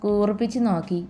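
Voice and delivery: usual speaking voice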